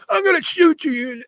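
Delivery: high, raging threat in a harsh put-on voice; threatening scream. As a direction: scream the threat at the blocked driver. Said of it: "the guy starts screaming".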